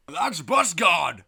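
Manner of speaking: high, loud, wrathful proclamation; God, terrifying and booming. Acted. God orders the mutiny celebration to cease, booming. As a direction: thunder down at the sinners. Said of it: "says God".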